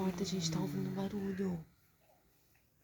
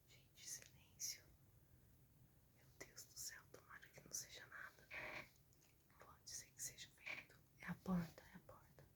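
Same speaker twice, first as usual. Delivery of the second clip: barely audible scared whisper; 5 semitones lower, 24 dB quieter than usual. Real, not acted. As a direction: breathe it out, afraid to be heard. Dad checking noise, hiding. (sussurro assustado)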